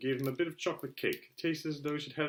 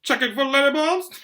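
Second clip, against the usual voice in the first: much louder and higher, jeering; mocking shout; 12 semitones higher, 13 dB louder than usual. Uncle Vernon jeering at Harry, a loud joke. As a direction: yell the joke from the kitchen.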